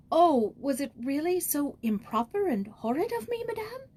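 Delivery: pleading worriedly for reassurance; a girl anxiously asking if she did wrong. Acted. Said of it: "asked Joyce anxiously".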